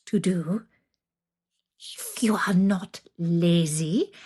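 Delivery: slow, half-whispered witch threat; menacing witch. Acted. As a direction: hiss the terms like a wicked hag. Witch voice held.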